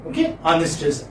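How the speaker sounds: ordinary talk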